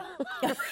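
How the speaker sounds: sudden high laugh; high burst of laughter. Real, not acted. A guest laughing at her own disastrous audition, a sudden high laugh.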